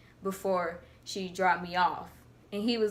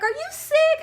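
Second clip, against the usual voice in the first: much higher, louder teacher question; concerned teacher; 6 dB louder than usual. Retold or imitated. Teacher coming over to the table, mock fussing question.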